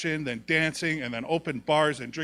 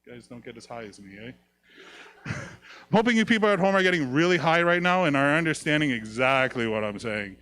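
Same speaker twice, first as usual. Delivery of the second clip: ordinary talk, nothing marked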